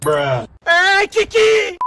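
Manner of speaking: loud call for a lost dog. Acted. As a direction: shout the dog's name. Calling the dog.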